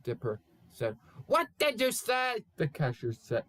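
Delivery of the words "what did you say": high shouted question; yelled. Acted. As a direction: yell it like a deaf old man.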